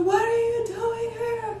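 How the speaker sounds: high-pitched delighted shock; surprised squeal. Retold or imitated. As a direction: gasp it out in disbelief. Retold surprise greeting.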